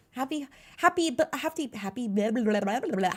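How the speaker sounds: flubbed words breaking into blah-blah gibberish; tongue-tied. Real, not acted. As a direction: stumble over the word, then babble nonsense. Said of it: "I know how to speak".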